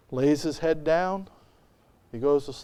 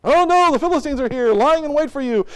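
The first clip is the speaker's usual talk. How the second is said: high, loud fake panic; mock alarm; 9 semitones higher, 5 dB louder than usual. Delilah fakes alarm to Samson, high mock panic. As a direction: cry out a staged warning. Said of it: "And what does she say?".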